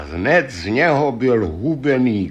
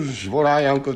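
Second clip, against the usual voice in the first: plain reading voice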